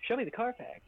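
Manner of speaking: usual speaking voice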